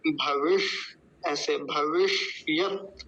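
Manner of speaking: भविष्यत in slow parts, bhavish-yat, with a held ष; retroflex ष. Shown as a demonstration. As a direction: hold the half ष of भविष्यत, then a short yat. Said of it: "बोलते समय कैसे बोला जाता है". (भविष्यत में मूर्धन्य ष, धीरे बोलें)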